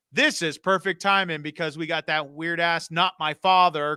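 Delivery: ordinary delivery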